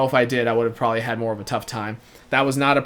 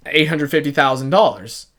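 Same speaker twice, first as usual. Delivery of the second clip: ordinary talk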